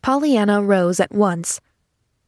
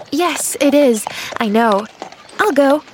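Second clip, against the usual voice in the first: put-on high, ready child's voice; happy little girl; 6 semitones higher, 3 dB louder than usual. A narrator's reading. Girl accepting the request, high and happy. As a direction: agree happily as a little girl.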